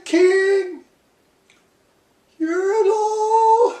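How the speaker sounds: very high, thin pleading voice, slow; humble little turtle. A narrator's reading. Aching turtle at the bottom pleading with the king, very high and slow. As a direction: beg politely from the bottom of the stack. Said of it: "looked up and he said".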